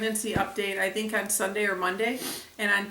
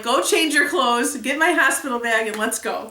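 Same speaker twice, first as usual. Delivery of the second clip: retold urgent orders; 4 semitones higher, 6 dB louder than usual. Acting her urgent orders to husband.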